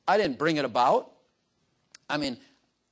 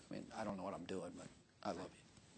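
much softer, quick murmured love; hushed aside; 7 semitones lower, 20 dB quieter than usual. Retold or imitated. Preacher voicing his private love for David, hushed. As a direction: drop almost to a murmur.

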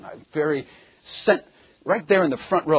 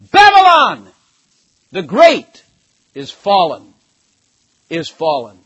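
mighty cry; 3 semitones higher, 12 dB louder, slower than usual. Acted. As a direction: cry it out like an angel over a fallen city. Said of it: "cried mightily with a strong voice".

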